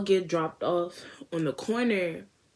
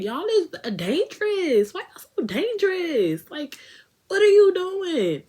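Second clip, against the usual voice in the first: high, loud, slow disbelief; squealing at reckless boys; shocked scolding; 13 semitones higher, 6 dB louder than usual. Retold or imitated. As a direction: scold them in high alarm.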